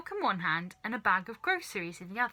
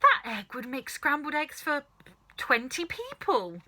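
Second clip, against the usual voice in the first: a higher, amazed call; excited shout; 6 semitones higher, 3 dB louder than usual. A narrator's reading. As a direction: marvel at the huge egg. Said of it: "Missus Cranky shouted".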